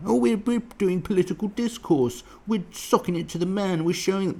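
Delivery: sarcastic impression of a preening activist; mocking protester voice. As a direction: parody their self-important chatter. Mocking protesters' self-importance.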